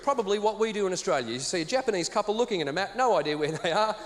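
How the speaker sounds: ordinary talk, nothing marked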